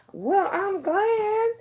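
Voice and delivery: drawn-out high voice, then giggle; high slow laughing. Real, not acted. High slow delighted aside, laugh.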